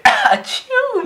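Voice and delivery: high, loud mock sneeze; fake sneeze. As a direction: sneeze it out loudly. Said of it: "alors tu fais".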